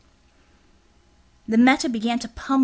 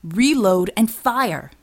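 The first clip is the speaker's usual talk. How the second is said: barked order; 5 dB louder, much slower than usual. Acted. Command in a fight.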